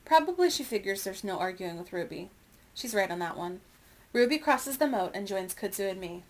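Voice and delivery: plain reading voice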